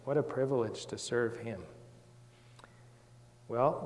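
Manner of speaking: ordinary delivery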